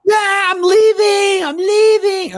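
high, loud, drawn-out yell; upset daughter. Retold or imitated. Dad imitating his daughter storming out of the house, high mock yell. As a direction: mimic a daughter yelling on her way out.

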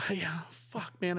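shaky whisper. Retold or imitated. Answering his partner at the door, whispered. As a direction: whisper back through the door.

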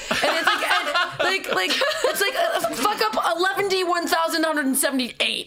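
rising, laughing disbelief; exasperated laughing outburst about a hopeless employee; laughing rant. Real, not acted. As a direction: rant it high through laughter. Ranting about employee's fuck ups.

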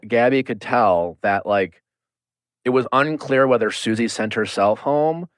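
ordinary talk, nothing marked

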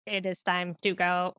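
mechanical robot announcement. Shown as a demonstration. Parent telling a child it's time to leave, as a robot. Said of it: "The robot's always good".

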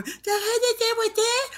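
high mimicking squeal; mock socialite voice. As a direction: imitate the dainty character in a high voice. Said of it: "which one of them people that you were doing".

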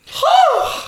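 high loud blissful sigh; swooning sigh. Real, not acted. Remembering the gelato, a high loud swooning sigh. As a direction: let out a high swoon of delight.

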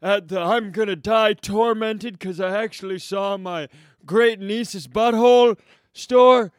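high, loud cartoon old man; tormented old man. Great-uncle tormented by what he saw on the stairs, old man. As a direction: wail it as a hammed-up old codger. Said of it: "It's an old man who, who helped his".

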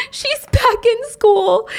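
high, slow, choked-up delivery; voice breaking. Real, not acted. Narrator tells Mimo's happy ending, voice breaking. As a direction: squeeze the words out slowly, pitched high.

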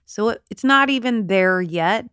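normal talking voice

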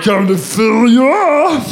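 slow, high, distorted monster shout; glitter monster voice. Acted. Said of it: "the glitter monster doing a weird voice thing".

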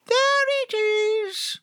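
high, slow little lamb; a sweet small lamb showing the way. Acted. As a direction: point it out gently in a tiny high voice. Lamb points out moon.

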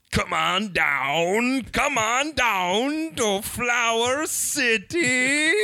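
slow, rising ad pitch; radio voice. Pretend. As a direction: drag it out like a local ad. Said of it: "give us your radio voice".

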